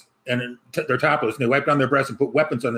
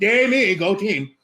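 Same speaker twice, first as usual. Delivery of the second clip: mock cheer; 7 semitones higher, slower than usual. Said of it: "and I'm like".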